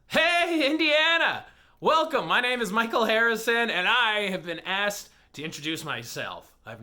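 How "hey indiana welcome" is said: high, bright hello to the crowd; excited greeting. Real, not acted. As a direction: call out to the room high above speaking pitch. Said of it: "greeting crowd loudly".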